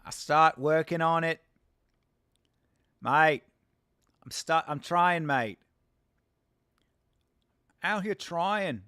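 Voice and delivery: put-on a clumsy Australian accent, slow on out here trying; bad Aussie accent. Streamer practising his Australian accent for chat, slowly and badly. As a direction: keep pushing the weak Australian accent. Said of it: "Yeah, it's pretty bad".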